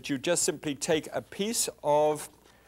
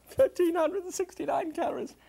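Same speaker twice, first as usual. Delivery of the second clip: mock horror. Real, not acted. Shocked by old recipe calories.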